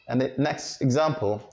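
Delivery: ordinary talk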